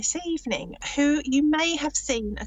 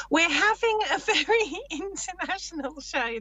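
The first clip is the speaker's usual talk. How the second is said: higher pitch, laughing through the words; 6 semitones higher than usual. Real, not acted. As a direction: giggle your way through it. Amused by colleague's botched accent.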